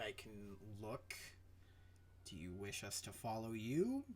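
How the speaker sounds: low, quiet captain with halting pauses; softly spoken, low-voiced negotiator; hesitant captain. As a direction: speak low and measured, pausing between phrases. Game master plays a non-player character.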